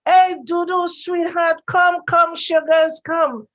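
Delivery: high, loud endearments called out; affectionate mother calling. Retold or imitated. Mom calling sweetheart and sugars to come, affectionate call. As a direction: call your darling over, voice lifted. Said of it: "but my mom would see you".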